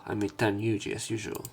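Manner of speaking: ordinary talk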